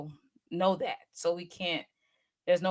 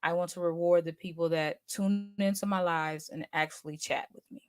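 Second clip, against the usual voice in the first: ordinary delivery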